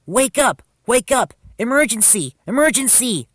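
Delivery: high, loud, urgent repeats; alarm shout. A narrator's reading. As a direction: rouse sleepers with an alarm call. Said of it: "shouted Thomas".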